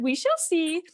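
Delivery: high, doubtful wife voice; the never-satisfied wife replying, high and doubtful; skeptical wife. Retold or imitated. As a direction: pitch it up as if unconvinced.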